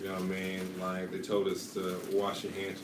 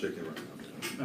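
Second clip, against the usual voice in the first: whispered; 9 dB quieter, slower than usual. Real, not acted. Teasing fear question, whispered.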